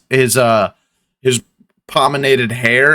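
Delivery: usual speaking voice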